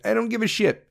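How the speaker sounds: high, dismissive shrug; mock shrug. Ranter voicing America's indifference with a high careless shrug. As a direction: toss it off carelessly. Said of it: "we're gonna be like".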